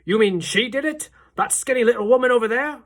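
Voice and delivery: loud, high indignant shout; outraged shout. A narrator's reading. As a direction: bellow it while pointing. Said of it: "shouted Mister Jenkins".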